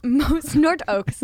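laughing, slow, very bad Irish accent; a mock Irish lilt, slowed down. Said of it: "That was the worst Irish accent".

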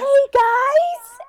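hammed-up excited hello; mock overexcited greeting. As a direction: burst in like a manic sorority girl. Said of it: "straight up psychopath".

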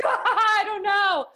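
high, laughing mock confusion, drawn out slowly; confused giggle. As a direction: play a baffled reader laughing helplessly. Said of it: "Some people out there would be like".

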